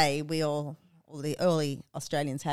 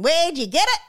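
high, loud ocker Australian "Where'd you get it?"; broad Australian ad; 10 semitones higher, 7 dB louder than usual. Retold or imitated. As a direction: belt it like a brash Aussie ad man. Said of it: "where the guy was going".

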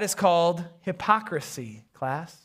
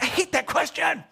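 high, squeezed mock complaint; comic dread; 8 semitones higher than usual. Pastor dreading being asked his job, high mock complaint. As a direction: whine it to yourself, pitch up. Comic inner reaction to question.

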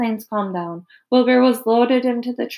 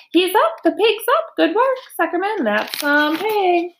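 much higher, excited cheering; cheering crowd; 9 semitones higher than usual. A narrator's reading. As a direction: cheer like a delighted fairground crowd. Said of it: "yelled the crowd".

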